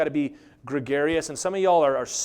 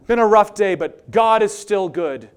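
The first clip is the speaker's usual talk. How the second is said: loud, slow, hammed-up brave sigh; mock pious post; 5 semitones higher, 9 dB louder, slower than usual. Mocking a Facebook post in a brave, hammed-up voice. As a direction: ham up the brave suffering post.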